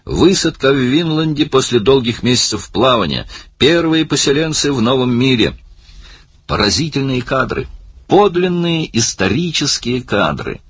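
high, exclaiming proclamation; excited calling out. A narrator's reading. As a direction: proclaim it like a triumphant film director. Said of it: "крикнул Барни".

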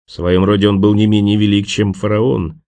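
ordinary delivery, nothing marked

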